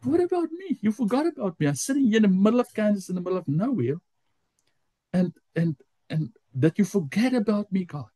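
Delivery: high, aggrieved plea; anxious, frustrated. Retold or imitated. Feeling forgotten in Kansas, pleads with God, frustrated. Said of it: "anxious and I get frustrated".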